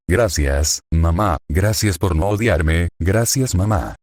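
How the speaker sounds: ordinary delivery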